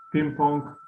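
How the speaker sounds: ordinary talk, nothing marked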